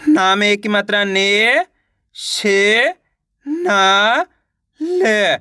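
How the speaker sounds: slow spelling up to a long rising "ने", then "शे, ना, ल" apart; teacher's spelled-out "ने, शे, ना, ल"; sounding out syllables. Shown as a demonstration. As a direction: stretch "ने", "शे", "ना" upward, drop on "ल". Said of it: "एक एक अक्षर का उच्चारण करके".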